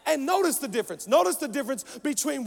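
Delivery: rousing, forceful sermon cadence; impassioned preaching. Real, not acted. Preacher promising vision to the conference crowd, impassioned.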